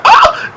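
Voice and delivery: very high startled shriek; a short shocked scream. Retold or imitated. Young lady on the show shrieking at the wig, shriek. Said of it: "she was like".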